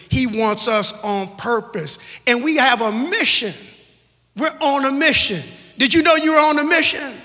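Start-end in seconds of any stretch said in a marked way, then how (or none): 2.3-7.1 rising, louder preacher's call; building preaching. Real, not acted. Preacher pressing the church about its mission, building. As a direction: drive the point home to the church.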